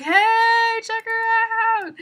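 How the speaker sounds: high loud drawn-out call; loud slow shouted tease. Retold or imitated. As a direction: shout it across the street, stretched slow. Shouting to vendors.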